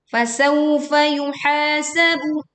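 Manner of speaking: slow recitation stretching "yuhasabu" on ha; long ha. Shown as a demonstration. Teacher modelling two-count lengthening, long-vowel chant. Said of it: "Yuha dibaca panjang".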